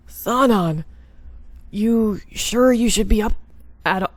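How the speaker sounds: wrong voice for the captain; a voice that slipped off character; botched character voice. Acted. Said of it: "now I'm messing up on everybody's voice".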